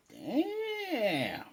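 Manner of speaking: long, high, drawn-out damn; stunned reaction. Retold or imitated. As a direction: stretch it out high in impressed shock. Speaker's stunned reaction to the retort.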